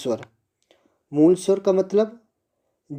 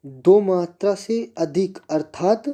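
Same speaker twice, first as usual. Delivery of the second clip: normal talking voice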